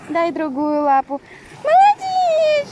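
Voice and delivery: loud, high praise for the dog; talking to a dog. Real, not acted. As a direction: cheer on the dog like a trainer. Owner training dog Max.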